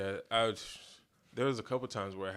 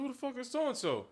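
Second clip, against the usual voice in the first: 1 high baffled voice. Retold or imitated. His own retold confusion.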